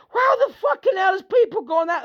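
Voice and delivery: high, loud outraged yell; outraged shout. Real, not acted. Ranting to viewers about humanity going wrong. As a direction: blast it at the camera.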